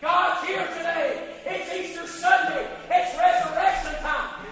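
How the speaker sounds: fervent preaching. Real, not acted. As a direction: preach it out with full force. Preacher at full force.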